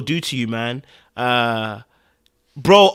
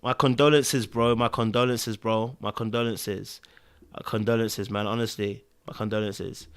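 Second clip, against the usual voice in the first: soft, low, solemn repetition; hushed condolences; 6 semitones lower, 7 dB quieter than usual. Real, not acted. Offering condolences to a bereaved character, hushed and low. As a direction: offer sympathy quietly, getting softer.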